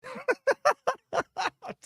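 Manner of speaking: high burst of laughter; big laugh. Real, not acted. Cracking up after doing the Matt Foley bit. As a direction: crack up at your own bit.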